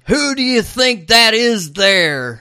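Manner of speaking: badly faked Southern accent, high and slow. Podcaster imitating [name]'s character, hammed-up Southern accent. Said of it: "horrible impersonation of [name] as a Southern guy".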